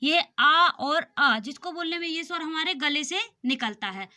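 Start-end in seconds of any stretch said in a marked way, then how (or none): 0.0-1.4 आ and आ stretched very slow and loud from the throat; slow loud throat vowels; vowel demo. Shown as a demonstration. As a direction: draw out आ from the throat. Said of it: "कंठ से स्वर निकले".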